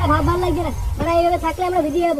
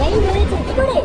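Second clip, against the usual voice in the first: shocked; 2 semitones higher, 4 dB louder, slower than usual. Acted. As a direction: pitch jumps up in shock. Shocked at news of death.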